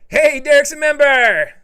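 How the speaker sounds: high, loud hollered greeting; excited shout-out. Real, not acted. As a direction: holler it out to celebrate a new member. Calls out a new member.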